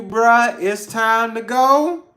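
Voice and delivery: loud, high, drawn-out call to leave; slow and loud, telling the group it's time; Pisces saying it's time to go. Retold or imitated. Pisces friend telling the group to leave, loud and drawn out. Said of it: "when a Pisces tell you".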